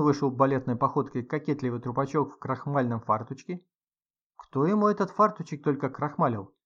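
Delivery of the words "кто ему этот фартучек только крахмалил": ordinary delivery, nothing marked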